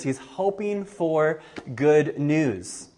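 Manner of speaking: ordinary delivery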